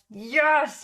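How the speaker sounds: high, triumphant cheer; retold delight. Co-host retelling being told she sounded Aussie, delighted. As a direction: celebrate it with a big high yes.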